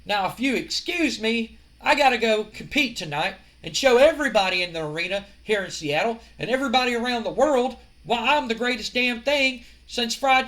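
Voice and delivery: a wrestler taunting the arena crowd, cocky promo shout. Retold or imitated. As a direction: strut through it like a cocky heel wrestler.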